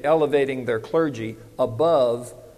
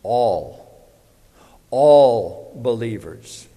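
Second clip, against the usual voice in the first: "all" drawn out slowly, then "All believers" louder and slow; stress on ALL; 4 semitones lower, 5 dB louder, much slower than usual. Shown as a demonstration. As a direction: lean hard on "All", stretch it. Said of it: "don't forget this word".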